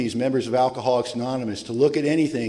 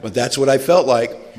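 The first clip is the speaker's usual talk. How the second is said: louder, laughing through the confession; laughing admission; 6 dB louder than usual. Real, not acted. Speaker to AA audience, laughing at his own ego.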